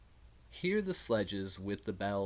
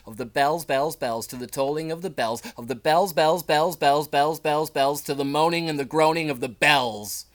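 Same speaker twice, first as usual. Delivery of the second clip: much louder, rising to a ringing peak; climactic loud refrain; 4 semitones higher, 12 dB louder than usual. Acted. Closing the poem for viewers, full-force climactic chant. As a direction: drive every "bells" home at full force.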